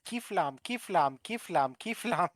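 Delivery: alien voice. Pretend. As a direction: screech it like a silly alien. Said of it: "Just put on an alien voice, start screaming out".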